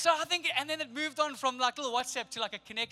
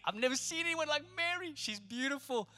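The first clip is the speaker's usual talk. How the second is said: high, loud, lovestruck whoop; smitten Joseph; 8 semitones higher, 10 dB louder, slower than usual. Acted. Joseph gushing over Mary, loud and high, lovestruck. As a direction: swoon over her like a dizzy young man.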